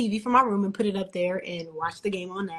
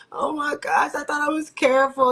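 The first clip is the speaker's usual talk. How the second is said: upset, near tears; 5 semitones higher, 5 dB louder than usual. Retold or imitated. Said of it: "I started crying".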